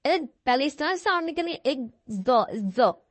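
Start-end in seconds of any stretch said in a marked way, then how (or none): 2.1-3.1 ज़ो with a buzzing z; z sound demo. Shown as a demonstration. Teacher showing the z sound of exhausted. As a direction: stress the z in ज़ो. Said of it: "ज़ की sound".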